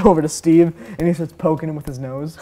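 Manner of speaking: normal talking voice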